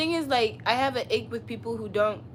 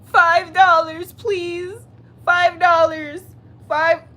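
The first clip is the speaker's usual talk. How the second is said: high, loud, slow whiny pleading; mock begging; 8 semitones higher, 12 dB louder, much slower than usual. Streamer mocking begging her chat for gifts, high whiny plea. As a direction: whine for five dollars like a beggar. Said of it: "Should I start begging?".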